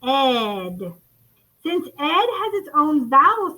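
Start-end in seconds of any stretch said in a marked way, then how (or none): 0.1-0.9 "ed" said as one held syllable, short E then D; isolated suffix sound. Shown as a demonstration. Teacher shows what suffix says, "ed" sounded alone. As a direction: sound out "ed" on its own, lower. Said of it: "the suffix says".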